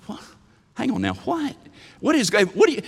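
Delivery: baffled rising what; confused what. Retold or imitated. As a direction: play the puzzled disciples, voice climbing. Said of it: "that kind of look like".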